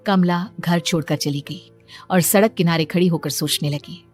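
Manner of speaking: usual speaking voice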